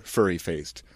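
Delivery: ordinary delivery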